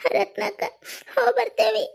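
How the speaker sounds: high, sobbing goodbye; crying farewell. Acted. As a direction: send them off while crying. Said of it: "ಯಾಕ್ ಅಳ್ತೀರಿ ಕಮಲ". (ಅಳುತ್ತಾ ಬೀಳ್ಕೊಡುಗೆ)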